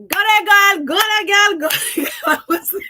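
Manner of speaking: loud, high Jamaican cheering; Jamaican accent. Retold or imitated. Fan cheering a sprinter on the curve, loud Jamaican accent. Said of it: "my Jamaican accent comes out".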